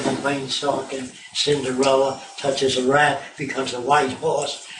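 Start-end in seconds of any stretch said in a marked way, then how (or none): none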